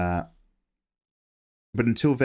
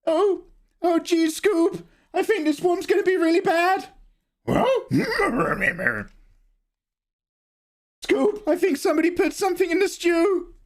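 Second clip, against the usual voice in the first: much higher, nervous cartoon slacker voice; Shaggy. Streamer tries Shaggy when chat asks, much higher. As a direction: squeak it out like a jittery cartoon hippie. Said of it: "Do Shaggy".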